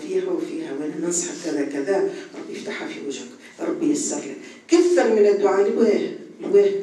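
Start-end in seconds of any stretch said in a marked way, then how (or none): 2.3-4.4 whispered blessing. Retold or imitated.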